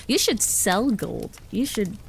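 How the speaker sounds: ordinary talk, nothing marked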